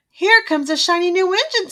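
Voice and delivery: funny little clown. A narrator's reading. Toy clown spots an engine for the stranded toys, lightly voiced. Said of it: "said the funny little clown".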